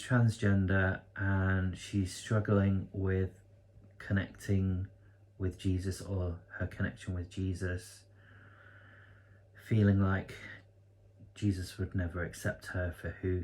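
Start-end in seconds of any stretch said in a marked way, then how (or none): none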